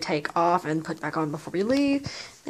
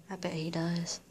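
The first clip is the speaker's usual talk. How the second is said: soft whispered aside; hushed whispered aside; 3 semitones lower, 11 dB quieter than usual. Real, not acted. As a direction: whisper it to yourself. Aside about the cat.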